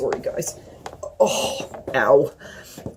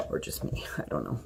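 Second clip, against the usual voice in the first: whispered aside; 4 semitones lower, 14 dB quieter than usual. Real, not acted. Muttered aside.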